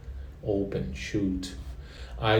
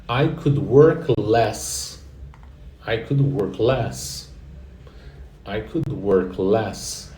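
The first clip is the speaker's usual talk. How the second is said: slow, clear "I could work less" for repeating; slow model; much slower than usual. Shown as a demonstration. Teacher models the sentence slowly for students to repeat. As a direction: spell it out slowly, word by word. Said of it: "Repete em voz alta".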